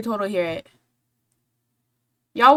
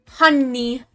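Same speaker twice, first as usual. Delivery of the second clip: put-on Brooklyn accent; 3 semitones higher, 7 dB louder than usual. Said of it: "I can't do a Brooklyn accent".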